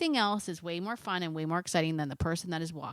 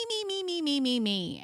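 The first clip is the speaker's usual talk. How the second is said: mocking me me me; 7 semitones higher than usual. Self-centred dog mocked.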